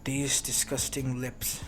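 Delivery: quiet menace. Acted. Enraged hero threatens the villain.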